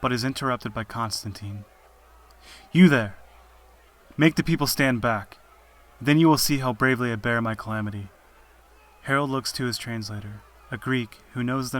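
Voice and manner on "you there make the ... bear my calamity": loud defiant call, easing slightly toward the end; bold, raised-voice order from a condemned man; commanding shout. Acted. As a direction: call out boldly over a crowd. Captive calls out to his executioner.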